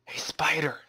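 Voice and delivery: hushed whisper to a spider. Real, not acted. Player creeping up on a spider, soft whisper. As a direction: whisper as if not to wake it.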